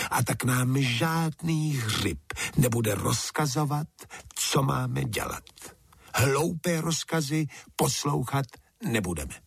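acted indignant speech, rising in pitch; proud defiance. A narrator's reading. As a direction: refuse orders as a proud toadstool. Another toadstool proudly refuses orders.

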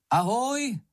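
high-pitched hail with a falling end; calling out. A narrator's reading. Moomin hails a passing traveller, calling out high. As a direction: call a hello across to someone. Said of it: "zavolal na něho Mumínek".

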